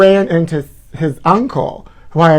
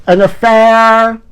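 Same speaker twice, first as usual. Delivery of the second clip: dramatic reveal; 6 semitones higher, 4 dB louder, much slower than usual. Acted.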